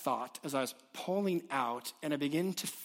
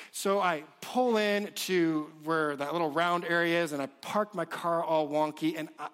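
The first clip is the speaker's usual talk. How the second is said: ordinary talk, nothing marked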